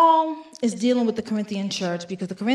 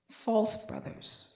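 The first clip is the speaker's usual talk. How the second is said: slow, soft whispered repeat; hushed rueful echo of the phrase; whispered aside; 10 dB quieter, slower than usual. Real, not acted. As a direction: breathe it to yourself, knowingly.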